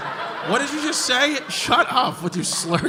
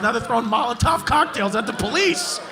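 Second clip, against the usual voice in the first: ordinary talk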